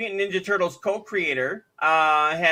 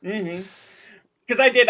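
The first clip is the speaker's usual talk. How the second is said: ordinary delivery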